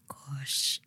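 sighed, hushed gosh; a real sighing whisper. Real, not acted. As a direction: breathe it out in dismay. Reaction to touchy topic.

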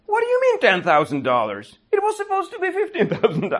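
mock outrage rising into a laugh; hammed-up indignation; fake angry. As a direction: pretend to be upset, cracking up at the end. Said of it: "I'm really angry about this too".

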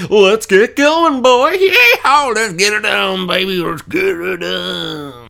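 over-the-top cowboy cheer; hammed-up cowboy holler. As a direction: whoop it like a rodeo cowboy. Hyping himself up to continue.

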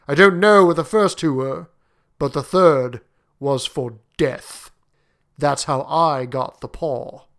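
slow, heavy soldier's tones; slow down gravely toward the end. Acted. Soldier telling the group of the first owner's death, gravely. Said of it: "His tones were so grave".

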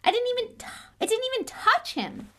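high, loud angry outburst; furious shout. Acted. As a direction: explode in outrage. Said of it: "his voice shaking with anger".

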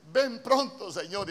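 loud, high cry of a congregation; fervent congregational cry; everyone calling out. Retold or imitated. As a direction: shout it like the whole church answering. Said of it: "Y todo mundo re-, dice".

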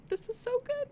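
high, choked-up tearful squeak. Retold or imitated. Reader moved at the book's ending, choked-up squeak. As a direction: squeak it out, holding back tears. Retold welling up at book ending.

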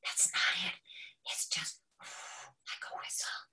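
gentle correcting whisper, slow and airless; slow soft unvoiced whisper to a child. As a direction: coach a child in a true whisper. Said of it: "and you go".

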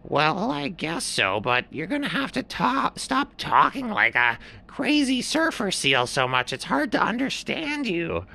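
a fussy bird complaining, pitched high; blue heron. A narrator's reading. Heron asking the seal to stop surfer talk, high. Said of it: "and the blue heron said".

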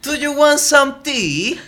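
very high, slow mock British; British accent. Lover offers tea to his guest, hammed-up British accent. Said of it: "más acento británico".

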